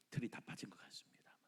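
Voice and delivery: breathy aside to the church; whisper. Real, not acted. Preacher whispers to the congregation about the bald prophet. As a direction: drop to a hushed whisper.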